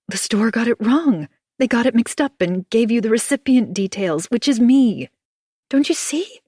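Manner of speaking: high, loud desperate pleading; plead, near hysterical, rising high; frantic pleading. Acted. Anna pleads desperately with June.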